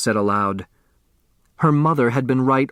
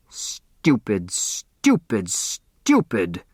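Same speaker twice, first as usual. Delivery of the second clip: low, bitter self-scolding; self-reproach; 3 semitones lower, much slower than usual. A narrator's reading. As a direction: berate yourself under your breath, in a lower voice. Judge berates herself.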